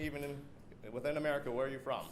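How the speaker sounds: ordinary talk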